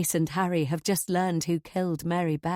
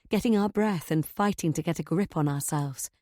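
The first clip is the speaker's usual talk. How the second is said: usual speaking voice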